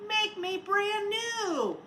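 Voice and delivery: slow, high melting snowman voice; voice from the hot tub. Acted. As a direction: stretch it out like a voice from the water. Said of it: "A voice from the puddle said".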